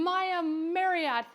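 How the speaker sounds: high, loud, slow sing-song name; calling out a name. Real, not acted. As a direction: stretch the name out to greet. Calling out a new follower.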